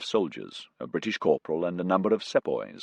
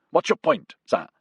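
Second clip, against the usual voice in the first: curt challenge; 9 semitones higher, 3 dB louder than usual. A narrator's reading. Grove challenging Ruddy.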